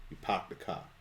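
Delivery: soft Boston accent without R's. Shown as a demonstration. Cohost showing Boston accent, R's taken out. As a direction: drop the R's, Boston style, quietly. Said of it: "You take the R out".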